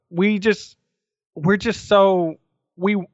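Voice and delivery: halting false starts; real, mildly tongue-tied hesitation; stuttering a little. Real, not acted. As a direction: stumble and restart while hunting for words. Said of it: "I know I'm stuttering a little bit".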